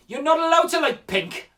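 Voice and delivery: high, loud Geordie telling-off; loud mock-outraged Newcastle accent. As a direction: imitate a bossy little Geordie boy. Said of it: "And he said".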